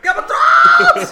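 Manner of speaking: very high, loud, stretched-out goofy yell; goofy dumb voice. Shown as a demonstration. Player shouting the show's name at the remote, goofy dumb voice. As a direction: shriek it like a giant dope. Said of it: "put on the goofiest accent or dumbest voice".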